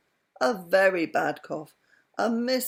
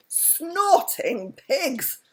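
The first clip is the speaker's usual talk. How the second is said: slow, loud, high exclamation, not a whisper; high, drawn-out loud oath; 9 semitones higher, 5 dB louder, much slower than usual. A narrator's reading. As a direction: blurt it out loud by mistake. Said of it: "said Mister Wumble with a whisper".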